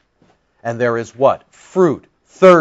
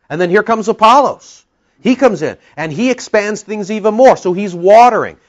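raised, emphatic preaching voice; 6 semitones higher, 4 dB louder than usual. Acted. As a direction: preach it out like Paul himself. Preaching Paul's words with force.

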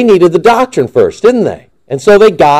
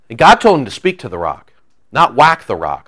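usual speaking voice

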